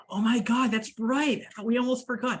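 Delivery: gasp, then high, excited surprise; surprised realization. Real, not acted. Host reminded of the forgotten topic, real surprised excitement. Gasps on suddenly remembering the topic.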